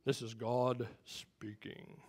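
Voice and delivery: low, slow, soft God voice; deep voice of God. Acted. Preacher mimicking movie voices of God, deep and slow. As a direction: play God speaking deep and unhurried. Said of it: "doing the voice of God".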